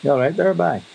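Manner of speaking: a County Down man hailing someone, high and bright; County Down accent. Retold or imitated. County Down greeting a pal, lilting high County Down accent. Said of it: "from County Down, you'll say".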